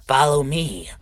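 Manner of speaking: high, loud call to follow; commanding master. Pretend. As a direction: summon your minion in character. Master orders kobold along.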